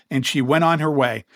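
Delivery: ordinary talk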